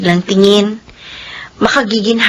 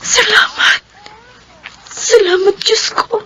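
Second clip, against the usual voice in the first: tearful thanks; 9 semitones higher than usual. Acted.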